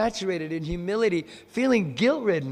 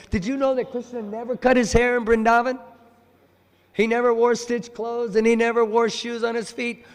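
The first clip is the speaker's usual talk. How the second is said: higher-pitched, chatty demonstration; casual remark; 6 semitones higher, 4 dB louder than usual. Shown as a demonstration. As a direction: toss out a fact as if casually chatting. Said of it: "But just casually".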